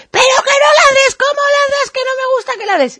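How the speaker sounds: high shrieking mock yell at the dog; imitated yelling owner. Owner shouting at the barking dog, shrill mock yell.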